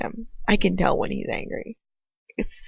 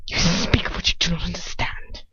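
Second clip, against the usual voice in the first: a cold whisper that gives her goosebumps; chilling whisper. Acted. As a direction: breathe it so it raises goosebumps. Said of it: "His voice pulls goosebumps".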